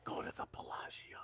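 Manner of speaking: eerie whispered command; voice in his head. Acted. Inner voice guides the arriving traveler, whispered. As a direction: whisper it like a mysterious prompting. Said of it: "the voice comes in his head".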